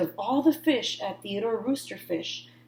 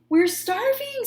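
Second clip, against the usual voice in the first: put-on much higher, complaining kids' voices; hungry friends; 3 dB louder than usual. A narrator's reading. Friends complaining to Clark about lunch. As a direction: whine like starving children. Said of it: "said his friends".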